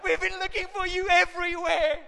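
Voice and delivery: high, loud exasperated scolding; frantic parent. Retold or imitated. Parent scolding the found child, high and loud.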